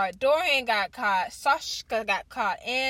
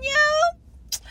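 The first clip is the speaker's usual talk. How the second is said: much higher, loud excited yo; hyped yo; 8 dB louder than usual. Real, not acted. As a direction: burst out with hype. Hyped about coming book scene.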